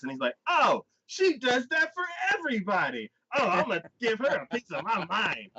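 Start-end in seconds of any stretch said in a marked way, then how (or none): 0.5-3.1 high-pitched mock outrage; hammed-up retold fuming; pissed off. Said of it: "so he gets all pissed off". 3.3-5.5 high-pitched mock indignation; hammed-up retold threat to tell her off; pissed off. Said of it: "so he gets all pissed off".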